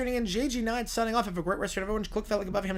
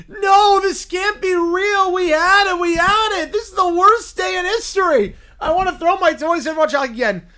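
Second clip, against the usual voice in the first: higher, louder tantrum wail; a sulking kid about to lose it; upset whiny bear; 9 semitones higher, 12 dB louder, slower than usual. Acted. Bear melts down.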